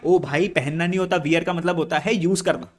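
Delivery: normal talking voice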